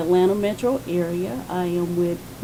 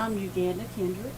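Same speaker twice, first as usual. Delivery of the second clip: soft and quiet, barely projected; 9 dB quieter than usual. Real, not acted. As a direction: say your name softly, almost to yourself. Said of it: "We're gonna need you to use your outside voice".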